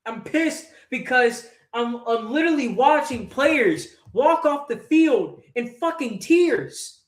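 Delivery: usual speaking voice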